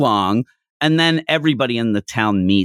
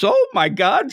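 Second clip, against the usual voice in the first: high, lightly voiced blushing granny; a hint of a flattered old woman; flustered old lady; 12 semitones higher than usual. Host imitating the blushing village elder, light flustered voice.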